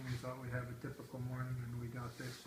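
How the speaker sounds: ordinary delivery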